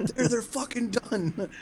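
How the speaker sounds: mock rage, high, slow and laughing; crazed agitation. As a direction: drag out a high-pitched pretend tantrum through laughter. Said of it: "agitation with everybody and start breaking things".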